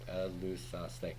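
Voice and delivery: Elusaste said slowly and softly, each syllable clear; careful model pronunciation. Shown as a demonstration. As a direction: repeat Elusaste slowly for students. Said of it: "second person plural, middle ending".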